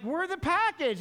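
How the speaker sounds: high, delighted announcement; spring the surprise gleefully; excited reveal. Retold or imitated. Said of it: "I'm like".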